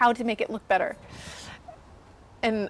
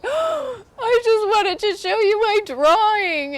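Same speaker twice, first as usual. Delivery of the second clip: put-on very high, teary, slow child whimper; little brother near tears after dad's critique, very high; crying little boy; 12 semitones higher, 6 dB louder, slower than usual. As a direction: play a wounded little kid about to cry.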